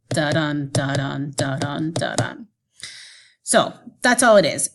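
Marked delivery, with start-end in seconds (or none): 0.1-2.5 "Da-dun" in steady pulses, "Da" short and "dun" longer and stressed; unstressed-stressed beat. Shown as a demonstration. Teacher asking students to listen again, "Da-dun" as a short-long heartbeat. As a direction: lean on each "dun" after a quick light "Da". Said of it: "hear the unstressed or the short syllable followed".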